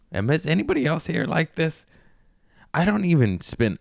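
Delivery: ordinary talk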